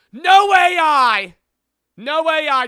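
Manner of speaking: high, drawn-out promo shout; hyped shout. Real, not acted. Streamer boasting to viewers about merch designs, shouting. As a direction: belt it out as a selling point.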